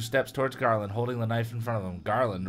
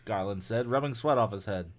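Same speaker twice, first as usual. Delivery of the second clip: usual speaking voice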